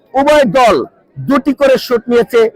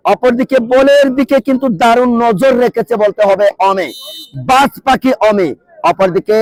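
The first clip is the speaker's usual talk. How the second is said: ordinary talk, nothing marked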